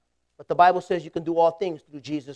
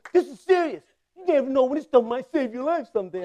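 higher, lightly voiced serious classmate; earnest recruit; 6 semitones higher than usual. Classmate telling the joker to stop, mock earnest. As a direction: scold him, half-mocking his earnestness. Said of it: "he goes".

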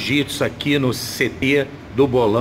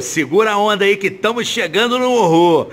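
excited; 7 semitones higher, 4 dB louder than usual. Real, not acted. Host's hyped sign-off.

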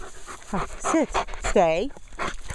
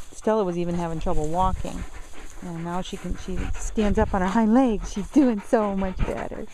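ordinary talk

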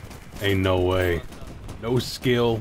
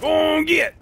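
exasperated shouting; 11 semitones higher than usual. Real, not acted. Chasing players out.

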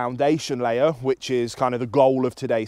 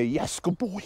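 high, softer sample of praise; praise marker; 6 semitones higher, 7 dB quieter, slower than usual. Shown as a demonstration. Trainer quotes the reward cue to camera, praise marker. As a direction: show the praise lightly, pitched up. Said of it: "this cue of coming to me".

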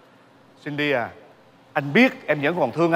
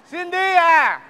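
higher, louder drawn-out shout of her name; loud desperate call; 11 semitones higher, 3 dB louder, much slower than usual. Acted. Husband shouting after his wife Cindy, a long high call. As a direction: yell across the room for her to come back.

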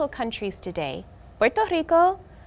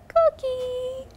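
high soft frog chirp; coqui frog call; 9 semitones higher, 3 dB quieter than usual. Acted. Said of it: "it sings softly".

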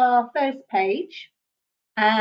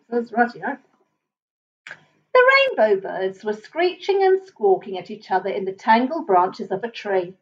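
ordinary delivery

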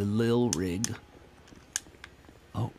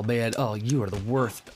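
normal talking voice

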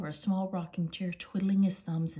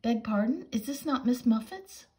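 higher, polite spider voice; 7 semitones higher, 5 dB louder than usual. Acted. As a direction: ask it like a courteous spider.

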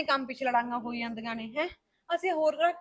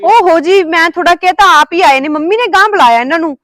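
higher and much louder, defending herself; loud protest when scolded; loud indignant protest; 6 semitones higher, 18 dB louder than usual. Acted. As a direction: fire back that it wasn't your doing.

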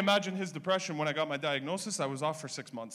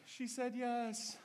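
sweet announcement; 6 semitones higher, 5 dB quieter, much slower than usual. Acted. Text message read out.

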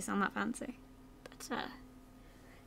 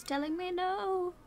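higher, slowed sing-song, long drawn no; playful higher drawn-out sing-song; 7 semitones higher, slower than usual. Pretend. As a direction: croon it like a little tune, stretching no. Sore throat, playful drawn-out line.

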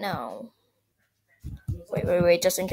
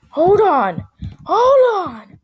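panicked shout; 8 semitones higher, 7 dB louder, much slower than usual. Real, not acted. Panicked game moment.

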